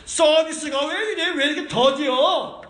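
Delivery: slow, drawn-out irritated grumble; mock complaint. Believer grumbling at God about slow service, mock irritated. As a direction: whine at God like an unhappy customer. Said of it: "하고 하나님한테 승질 부리잖아".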